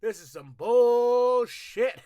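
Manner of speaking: loud, slow indignant complaint; angry rant. Retold or imitated. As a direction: rant it out like a furious wrestler. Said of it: "He's angry".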